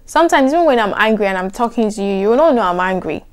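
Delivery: normal talking voice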